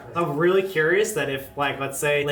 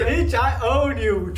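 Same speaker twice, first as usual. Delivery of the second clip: playful dog voice; 9 semitones higher, 4 dB louder, slower than usual. Acted. Voicing the dog.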